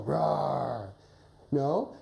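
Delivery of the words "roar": low, drawn-out roar; wolf roar. Shown as a demonstration. Coach repeating the sound for a young wolf, roaring. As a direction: growl out a playful wolf roar. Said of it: "Can you make that voice".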